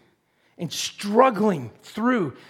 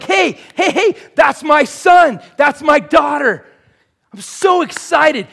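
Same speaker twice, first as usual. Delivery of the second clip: much higher, loud joyful shout; excited God; 9 semitones higher, 11 dB louder than usual. Pretend. Preacher voicing God calling to his children, loud and excited. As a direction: call out like a thrilled dad. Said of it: "instead he's just like".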